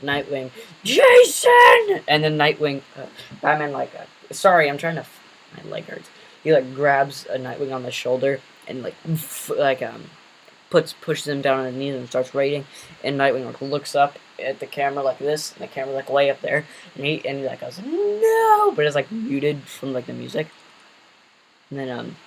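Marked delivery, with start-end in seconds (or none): 0.8-2.0 high, loud shout of a name; anguished shout. Acted. Nightwing finds Jason gone, screaming his name. 17.8-18.7 long, high, loud wail; anguished cry. Acted. Nightwing on his knees, crying out in despair.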